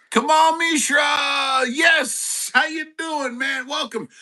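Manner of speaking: high, loud, drawn-out call; excited cheer. Real, not acted. Host cheering a viewer into the stream, loud and slow. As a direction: stretch it out like cheering someone in.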